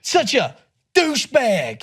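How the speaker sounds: high-pitched American insult; punchy American swearing; American-accent cursing. Shown as a demonstration. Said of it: "can't curse in an American accent".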